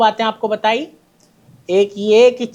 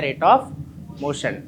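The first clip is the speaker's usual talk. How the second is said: "Rate of motion" stretched out very slowly, word by word; slow dictation; 6 semitones lower, 4 dB quieter, much slower than usual. Shown as a demonstration. Teacher dictating the term to students, very slowly drawn out. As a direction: drag out "Rate of motion" so the class can write it down. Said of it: "क्या आता है?".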